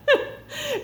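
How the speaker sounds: a sudden, high squeal of a laugh; high burst of laughter. Real, not acted. Laughs at her own realization.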